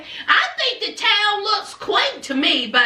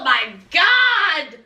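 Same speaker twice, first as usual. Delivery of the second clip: ordinary delivery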